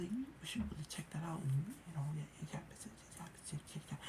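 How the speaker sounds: breathy whispered mock advice, fast and hushed. Narrator mocking the teacher to his mom, whispered.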